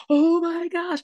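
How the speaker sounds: high, breathless oh my gosh; a high thrilled rush of oh my gosh; excited gasp. Retold or imitated. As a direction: squeal it out in disbelief. Grabbing sister, excited.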